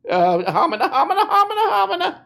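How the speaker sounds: high, laughing homina homina babble; flustered stammer. Retold or imitated. Mock Peterson lost for words, high laughing babble.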